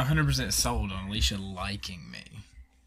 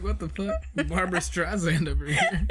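laughing disbelief; 7 semitones higher, 7 dB louder than usual. Retold or imitated. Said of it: "I'm like".

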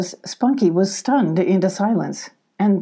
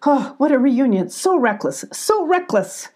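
high, fussy lament with falling ends; fretful cry; 6 semitones higher than usual. A narrator's reading. Billy frets over the reckless reunion, high fussing cry. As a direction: tut and fret in a high voice. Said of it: "cried Billy".

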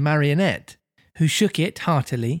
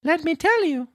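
very high puppet voice; Pinocchio explaining; 13 semitones higher than usual. Acted. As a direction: explain as a squeaky little marionette.

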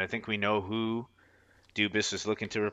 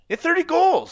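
incredulous; 8 dB louder than usual. Real, not acted. Repeats stat in disbelief.

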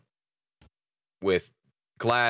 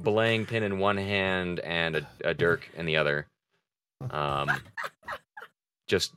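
ordinary talk

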